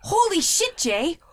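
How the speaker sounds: imitating a Boston guy amazed on a boat; excited Boston guy. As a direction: yell it like you've never seen a fish. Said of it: "and he goes".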